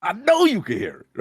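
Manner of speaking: loud, high imitation of a game character; game character quote. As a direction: push it up high and insistent.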